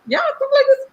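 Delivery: high-pitched fake persona; put-on act voice. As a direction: stay in the exaggerated act. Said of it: "I was, like, acting".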